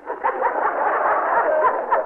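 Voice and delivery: short derisive laugh; scornful laugh. Acted. Scoffs at threat.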